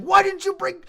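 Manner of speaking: high, loud aggrieved whine; mad. Retold or imitated. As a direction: wail it like cheated, outraged friends. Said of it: "they were mad".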